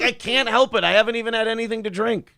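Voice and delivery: put-on high, whiny drunk protest; drunk girlfriend voice. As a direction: play her tipsy and defensive. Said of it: "She's like".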